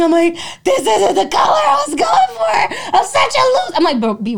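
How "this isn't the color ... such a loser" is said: mock-sob through laughter; berating herself over the hair color, high laughing wail; laugh-crying wail. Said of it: "I'm, like, crying".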